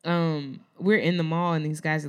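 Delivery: ordinary talk, nothing marked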